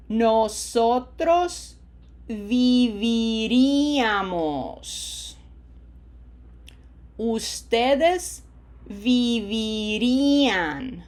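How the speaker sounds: slow Spanish model with long held I sounds; lengthened vowels. Shown as a demonstration. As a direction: draw out each vowel for the learner. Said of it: "it is easier to lengthen the vowels".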